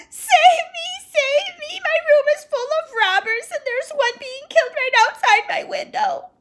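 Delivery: put-on high, loud, panicked girl's shriek ending in a hoarse whisper; terrified cry for help. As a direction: scream for help as if robbers were in the room. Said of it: "With a shriek".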